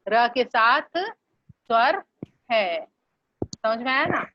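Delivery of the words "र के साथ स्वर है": र के साथ स्वर spoken very slowly and deliberately; very slow explanation. Shown as a demonstration. Teacher slows down to explain र with a vowel. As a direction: spell it out slowly, word by word. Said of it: "अब इसके साथ स्वर नहीं है".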